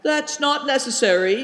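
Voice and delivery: put-on a touch of a cry to a worried boy; mild cry. A narrator's reading. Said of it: "he cried".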